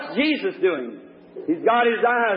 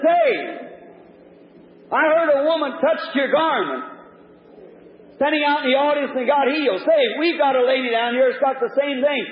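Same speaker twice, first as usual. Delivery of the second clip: raised-pitch call from a mocking onlooker; heckling challenge; 7 semitones higher than usual. Onlooker daring the healer to cure a lady, heckling. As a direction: call out a dare from the crowd. Crowd member challenges the prophet.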